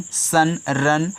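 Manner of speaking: usual speaking voice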